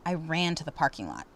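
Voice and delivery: ordinary delivery, nothing marked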